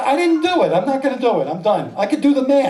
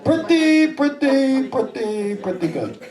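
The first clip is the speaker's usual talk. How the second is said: Larry David; 5 semitones higher, slower than usual. Acted. Comic doing his other impression, drawling and descending. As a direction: start high and sink with each repeat. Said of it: "I sound like Larry David".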